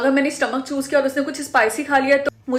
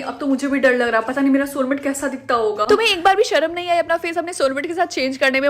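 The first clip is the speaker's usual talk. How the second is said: ordinary talk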